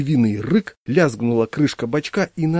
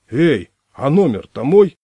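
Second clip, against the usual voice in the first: loud, higher, slow call from the landing; shouting through the door; 4 semitones higher, 8 dB louder, slower than usual. Acted. Locked-out squatter shouting from the landing, loud and slow. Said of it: "потом крикнул".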